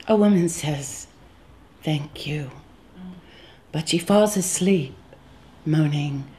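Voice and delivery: slow, measured lines with long pauses; poetry reading. Acted. Reads own poem aloud.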